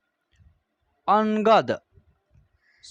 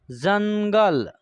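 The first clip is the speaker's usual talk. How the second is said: slow demo word; much slower than usual. Shown as a demonstration. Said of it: "उसके बाद".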